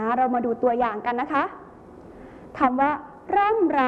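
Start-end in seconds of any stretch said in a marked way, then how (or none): 3.4-3.8 ร่ำไร raised in pitch, each R distinct; clear consonants. Shown as a demonstration. Teacher modelling a word for pupils, ร่ำไร with clear R's. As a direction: sound out both R's in ร่ำไร carefully. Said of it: "อ่านออกเสียงพยัญชนะให้ชัดเจน".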